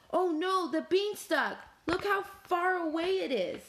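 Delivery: high, swooping "oh no"; storybook alarm, pitched high; dismayed cry. A narrator's reading. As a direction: wail mildly at how far it is. Dismay at distant beanstalk.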